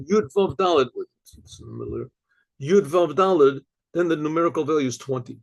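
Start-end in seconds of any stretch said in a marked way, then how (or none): none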